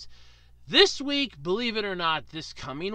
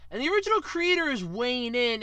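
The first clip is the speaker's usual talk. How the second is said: usual speaking voice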